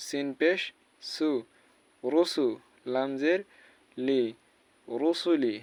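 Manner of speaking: normal talking voice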